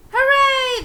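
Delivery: high, loud joyful cheer. A narrator's reading. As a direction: cheer like delighted kids. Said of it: "the boys cheered".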